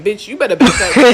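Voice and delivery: loud retold warning to a friend; urgent warning. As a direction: push it out loud, pulling her back.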